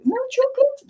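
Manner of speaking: very high questioning squeak; high squeaky plea. Shown as a demonstration. Showing a sympathetic high voice, very high. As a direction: ask it up high and small. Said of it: "Whereas you go".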